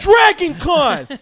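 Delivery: high, loud, giddy put-on voice; mocking eager sucker. Mocking eager convention hopefuls to his cohost, high and giddy. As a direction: squeal it like an overexcited rube. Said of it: "you think I'm just gonna jump?".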